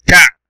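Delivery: تع short and loud, closed hard on the ayn; abrupt, punched تع; stop on ayn. Shown as a demonstration. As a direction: cut the syllable تع at the ayn. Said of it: "توقف عند العين".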